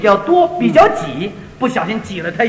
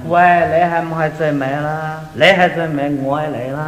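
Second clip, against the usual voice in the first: Cantonese speech; 8 semitones lower than usual. Retold or imitated. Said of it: "广东人这句话讲究".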